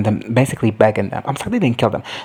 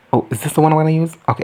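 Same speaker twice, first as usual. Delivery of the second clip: ordinary talk, nothing marked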